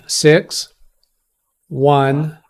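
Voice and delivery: slow, separate digits; over enunciating. Shown as a demonstration. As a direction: read the numbers out carefully. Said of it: "I need you to not repeat".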